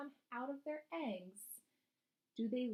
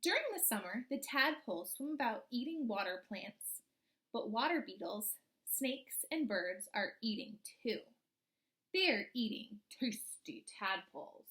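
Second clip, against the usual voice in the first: storybook reading; 5 dB louder than usual. Acted. Teacher reading a frog book to her class, storybook voice. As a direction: read it like a picture book to the class.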